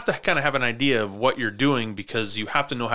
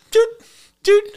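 short punchy "doot" sounds; imitated dodge noise; 10 dB louder than usual. Host retelling how the kid dodged everybody, imitated darting noises. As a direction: mimic quick sidesteps with two blips. Mimics kid dodging the players.

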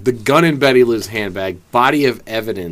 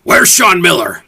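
higher, louder angry yell; angry shout; 8 semitones higher, 8 dB louder than usual. Pretend. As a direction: bark it out like a furious chef. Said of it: "Now say her name angry at me".

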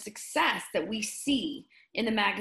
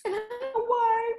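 real tears catching in the throat; choked up; 13 semitones higher, 4 dB louder than usual. Real, not acted. Telling the kind UPS clerk story, getting choked up. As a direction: voice cracking up high as emotion takes over. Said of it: "I just get so choked up".

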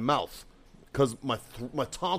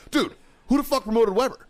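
much higher-pitched insistent question; exasperated demand; 11 semitones higher, 4 dB louder than usual. Retold or imitated. Marine pressing first sergeant for an answer, exasperated demand. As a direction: push the question up in outraged disbelief.

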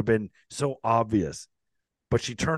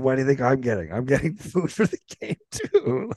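higher, giggling through the words; laughing; 3 semitones higher than usual. Real, not acted. Retelling his snarky thought to the host, laughing. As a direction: crack up mid-sentence.

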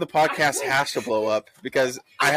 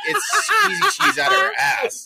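laughing shout; 14 semitones higher, slower than usual. Real, not acted. Laughing disbelief at own answer.

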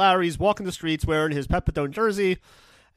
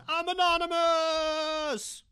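much higher, very slow drawn-out reveal; dramatic Larry line; much slower than usual. Retold or imitated. Larry shouting over the wall to the staff, slow and high. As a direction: stretch it like a big dramatic reveal. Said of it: "he says".

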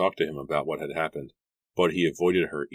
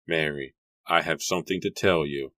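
usual speaking voice

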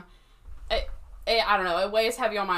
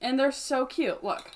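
ordinary delivery

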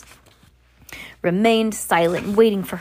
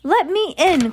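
higher, calling through a door; a boy calling through the door; 9 semitones higher, 4 dB louder than usual. Acted. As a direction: call out from behind closed doors. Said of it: "called the boy's voice from behind the doors".

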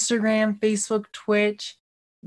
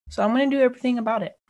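fast; 3 semitones higher, much faster than usual. Acted. Repeating a vow.

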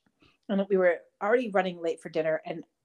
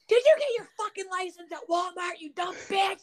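much higher furious yell; yelling at driver; 11 semitones higher than usual. Retold or imitated. Wife yelling at the stalled driver, much higher furious yell. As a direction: scream it out the window at her. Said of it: "and I go".

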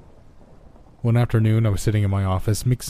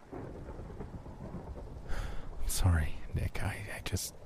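very soft, halting, almost whispered; slow, faint, faltering voice; hushed apology; 13 dB quieter, much slower than usual. Acted. Tanner apologising to Nick, hushed and halting.

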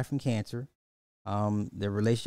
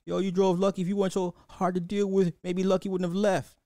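loud, higher voice of an angry son; son yelling at mother; 5 semitones higher, 5 dB louder than usual. Retold or imitated. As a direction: call her out, raised and high. Son blaming mother in film.

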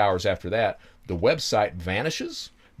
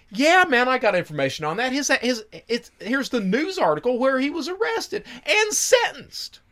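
high, louder put-on informant; excited high informant voice; informant's voice; 3 dB louder than usual. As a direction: play the tipster up high and loud.

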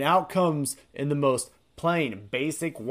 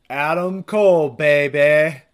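loud, excited announcer call; hype shout; 9 dB louder, slower than usual. Real, not acted. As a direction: belt out the name like a ring announcer. Hyping a surprise entrance.

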